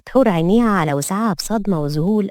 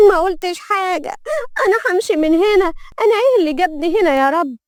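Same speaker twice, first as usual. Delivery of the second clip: very high, protesting wail; distressed young maid; 11 semitones higher than usual. Acted. Maid protesting to the mistress attacking her, distressed. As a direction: protest in a high-pitched, upset voice.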